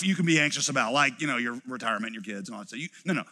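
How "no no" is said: usual speaking voice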